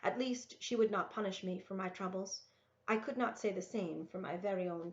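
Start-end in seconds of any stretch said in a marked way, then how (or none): none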